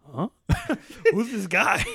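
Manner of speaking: put-on cracking up while quoting the boys; laughing through quote. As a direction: barely get it out for laughing. Boys' confused reaction, retold laughing.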